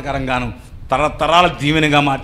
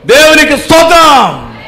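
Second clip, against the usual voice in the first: shouted praise; 11 semitones higher, 11 dB louder, slower than usual. Real, not acted. Preacher praises God aloud.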